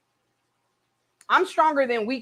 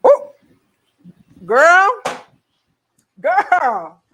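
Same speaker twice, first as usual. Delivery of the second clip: excited; 6 semitones higher, 10 dB louder, much slower than usual. Real, not acted. Reacting to tasting the sauce.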